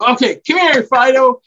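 high, loud call to the dog. Shown as a demonstration. Parent calling the family dog to help clean up. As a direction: sing out for the dog to come.

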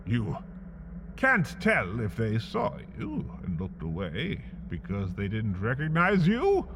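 usual speaking voice

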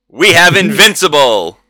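loud boxing-ring introduction; fight announcer. Acted. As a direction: call out the fighter's name big.

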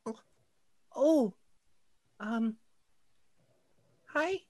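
put-on high, hesitant child voice; a caught kid stammering; shy little girl.

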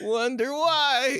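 laughing tease. Retold or imitated. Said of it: "repeats the tease, higher".

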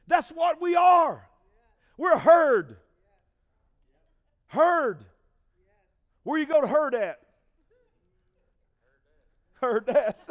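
loud, emphatic preaching. Real, not acted. Preacher teasing the congregation about the herd, loud. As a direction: hammer the word herd.

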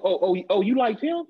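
high, teasing fake interest; mock taunting. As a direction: tease them with a rising question. Acting out anime taunting the viewer.

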